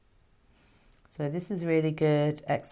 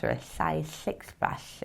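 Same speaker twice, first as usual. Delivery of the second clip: slow slurpy slug; 3 semitones higher, 4 dB quieter, slower than usual. Pretend. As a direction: say it like a slimy slug. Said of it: "if you're a slug".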